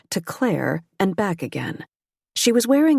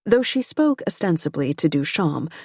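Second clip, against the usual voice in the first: normal talking voice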